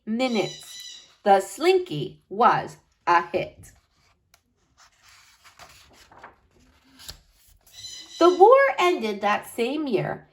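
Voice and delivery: expressive storybook read-aloud for a class; lively picture-book narration with slowed key words; reading to children. Acted. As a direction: read it like story time, drawing the kids in. Teacher reads picture book aloud.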